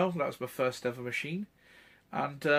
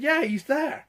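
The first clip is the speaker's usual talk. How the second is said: high, louder, gleeful; excited reply; 14 semitones higher, 8 dB louder, slower than usual. Retold or imitated. Friend telling the fan his hero is right there, gleeful. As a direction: point him out with glee.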